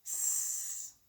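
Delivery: S said as a long voiceless hiss, sss. Shown as a demonstration. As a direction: hiss the S like a snake. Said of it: "I bet you know what sound an S makes".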